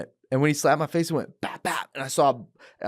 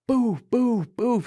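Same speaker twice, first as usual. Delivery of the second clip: punch sounds; 11 semitones higher, 3 dB louder, slower than usual. Retold or imitated.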